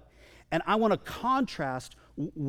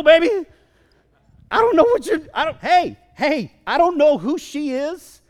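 high, loud protest from a desperate father; frantic outburst; 7 semitones higher, 7 dB louder than usual. Acted. Desperate dad urging Jesus to leave the woman, frantic and loud. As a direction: burst out in agitated, impatient disbelief.

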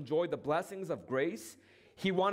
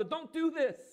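high pleading plea, much higher; pleading brother; 10 semitones higher than usual. Acted. Elder brother begs younger brother to stay, pleading. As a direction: beg him not to go, voice lifted high. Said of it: "pleading with the younger brother".

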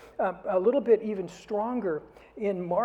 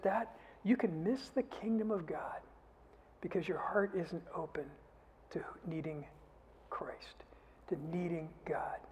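softer, breathy whisper; whispered plea; 9 dB quieter than usual. Real, not acted. Preacher whispering to the congregation about needing God, hushed. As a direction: lean in and whisper it to them. Intimate plea to need Christ.

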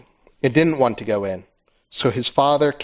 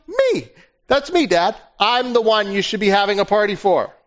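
higher, aggrieved complaining voice; whining older brother; 8 semitones higher than usual. Acted. Older son complaining to his father, whiny and high. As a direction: plead your case, hurt and resentful. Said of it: "He says in verse twenty-nine".

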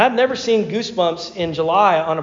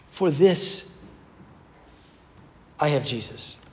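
lower, softer mantra; solemn mantra; 4 semitones lower, 6 dB quieter than usual. Shown as a demonstration. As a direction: say it like a quiet creed. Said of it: "could we just say that together".

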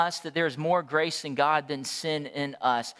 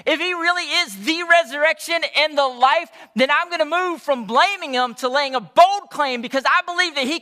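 rising, louder and louder preacher's build; preaching at full force; 7 semitones higher, 6 dB louder than usual. Acted. Preacher building to a loud climax before his church. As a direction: drive the claim home to the congregation.

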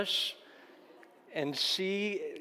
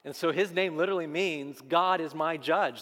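ordinary talk

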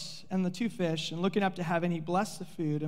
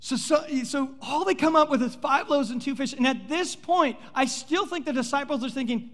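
ordinary talk

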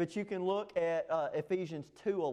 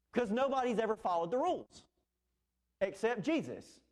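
ordinary talk

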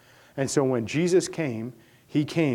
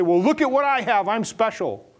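a hammed-up proud voice, pitched up; mock boast; 9 semitones higher, 7 dB louder than usual. Pastor mocking the proud who say look what I have, with a mock boast. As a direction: sneer it like a smug show-off.

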